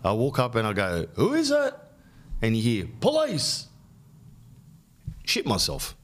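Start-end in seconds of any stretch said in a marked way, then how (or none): 1.1-1.7 high-pitched call to the door; retold high singsong holler. Calling to whoever is knocking. 3.0-3.7 high-pitched mock holler; retold police announcement, pitched way up; imitated police call. Imitating police calling through door.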